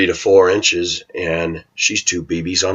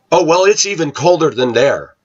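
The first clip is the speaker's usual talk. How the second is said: high, smug know-it-all correction; mock neighbor topping the claim; one-upping neighbor; 8 semitones higher than usual. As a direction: top his story with a higher voice.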